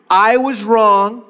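slow, each word weighed; slow deliberate confession. Shown as a demonstration. Pastor to congregation, slow heartfelt confession. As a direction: say it slowly and mean it. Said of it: "you ready for this?".

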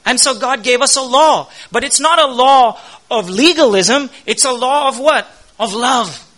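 full-force preaching. Acted. Pastor rousing the church about God's law, full-force preaching. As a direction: preach it hard to the congregation.

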